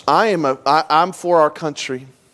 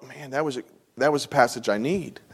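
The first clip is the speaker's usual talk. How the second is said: hushed inner realization; 5 semitones lower, 9 dB quieter than usual. Retold or imitated. Inner voice of a struck listener.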